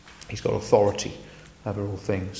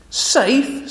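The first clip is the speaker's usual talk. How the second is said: high, louder scoffing question; can't believe she asked; incredulous Beaver; 12 semitones higher, 4 dB louder than usual. Acted. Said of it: "said Mister Beaver".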